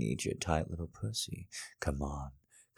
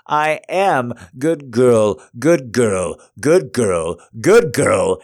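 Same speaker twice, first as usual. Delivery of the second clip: shouting; 6 semitones higher, 13 dB louder than usual. Acted. Dominant partner climaxing, shouting.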